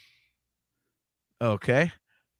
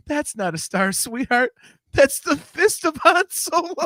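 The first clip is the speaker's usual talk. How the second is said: laughing mother voice; 11 semitones higher, 4 dB louder than usual. Acted.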